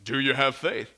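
low, composed question; calm voice from above. Acted. Heavenly voice questions the tourist, calm. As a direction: ask it low and untroubled. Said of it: "the calm voice".

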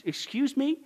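normal talking voice

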